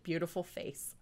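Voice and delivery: ordinary delivery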